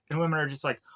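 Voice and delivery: normal talking voice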